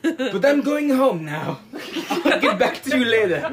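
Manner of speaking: high mock French accent, drawn out; hammed-up French detective exit line; Poirot. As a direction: declaim grandly in badly faked French accent. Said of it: "And he's like".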